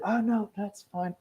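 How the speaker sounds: mock innocent, slow and light; playing dumb. Speaker mimics a two-faced colleague, playing innocent. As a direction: fake innocence to cover sabotage. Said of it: "act all innocent and play dumb".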